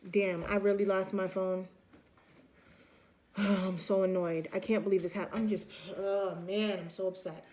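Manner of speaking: a light act of gloom about losing a phone; mock sad. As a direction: mope over the lost phone, sighing. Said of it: "feelings of sadness and feelings of loss".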